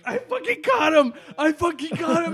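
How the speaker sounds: high, loud gleeful shouting; excited shout. Retold or imitated. Telling friends how Greg cheered catching a bird, excited shout. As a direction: yell it like you just landed a trophy. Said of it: "And he's like".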